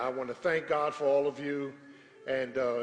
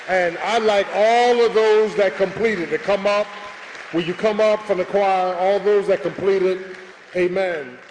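calling the choir up loudly and higher; 4 semitones higher, 10 dB louder than usual. Real, not acted. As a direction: summon them forward from the pulpit. Pastor calling choir forward.

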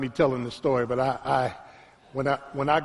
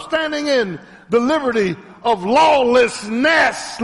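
fiery preaching; 6 semitones higher, 5 dB louder than usual. Acted. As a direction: preach it hard to the church, drawing out every phrase. Preacher building up the message.

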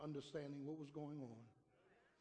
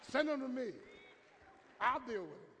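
1 loud challenge; 7 semitones higher, 9 dB louder than usual. Real, not acted. Pastor offering to discipline kids.

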